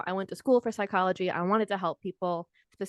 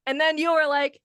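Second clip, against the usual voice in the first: ordinary talk, nothing marked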